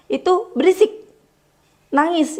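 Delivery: high echo of "berisik"; echo of scold. Shown as a demonstration. What the child's brain hears, high mock scold. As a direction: mimic the scolding word, pitched high. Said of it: "yang didengar sampai ke otaknya".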